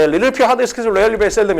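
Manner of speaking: memorised list, reeled off without pauses; quick rote chant of English words; rapid-fire recitation. Shown as a demonstration. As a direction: rattle the word list off in one run. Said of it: "그냥 버버버 하면 돼".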